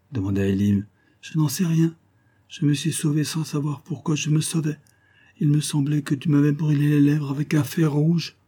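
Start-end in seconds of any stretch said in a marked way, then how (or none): 1.2-8.3 lightly voiced girl, higher and shy; a hint of a bashful young girl; young girl's voice. Jane shyly explaining to Elim why she pushed him away, lightly voiced.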